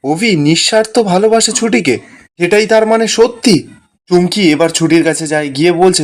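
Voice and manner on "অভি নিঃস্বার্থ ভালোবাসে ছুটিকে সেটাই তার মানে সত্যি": higher-pitched disbelieving question; incredulous, raised-pitch thought; shocked inner thought. A narrator's reading. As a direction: react in stunned disbelief, voice lifted. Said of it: "মনে মনে বলছে".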